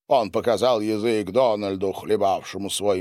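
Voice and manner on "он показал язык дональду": usual speaking voice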